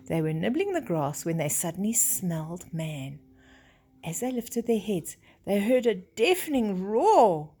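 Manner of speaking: usual speaking voice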